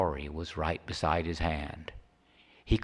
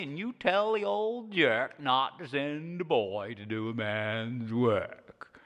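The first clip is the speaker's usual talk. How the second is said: higher, drawn-out jeer; high old codger scorn; cranky Charlie taunting; 4 semitones higher than usual. Acted. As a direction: taunt the absent boss.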